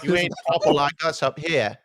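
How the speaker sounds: put-on period English accent; old-time British accent. Co-host looking down on a Southerner, mock old-time British accent. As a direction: play a snobbish old-fashioned Englishman, lightly. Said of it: "He's saying the accent's from a hundred years ago".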